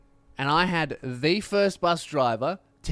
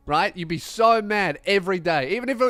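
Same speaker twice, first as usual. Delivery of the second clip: ordinary talk, nothing marked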